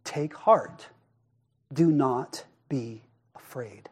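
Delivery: soft, slow, hushed comfort; calm them gently, almost hushed; softly reassuring frightened disciples; gentle reassurance. Acted. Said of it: "Jesus spoke to them and said".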